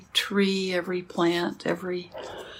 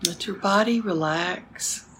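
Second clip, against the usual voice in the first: soft spa whisper; 7 dB quieter than usual. Acted. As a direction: ease them into stillness. Slow meditation cues.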